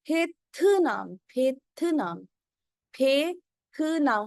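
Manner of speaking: teacher modelling फेथनाम, slow and careful; slow pronunciation demo. Shown as a demonstration. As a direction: spell out फेथनाम slowly for the class, breathy फ at the start. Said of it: "फेतनाम कोई नहीं बोलेगा".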